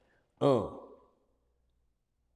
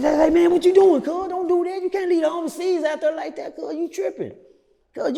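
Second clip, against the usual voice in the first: upset protest; 8 semitones higher than usual. Retold or imitated. Other inmate protesting him walking off.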